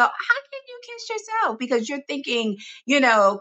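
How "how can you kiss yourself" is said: high, incredulous squeak of disbelief; mock-shocked high-pitched question; baffled skeptic. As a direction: play the baffled doubter asking how that's even possible. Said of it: "most people go".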